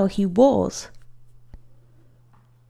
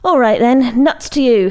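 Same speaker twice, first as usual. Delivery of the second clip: annoyed; 4 semitones higher, 7 dB louder than usual. A narrator's reading. Annoyed boy snaps back.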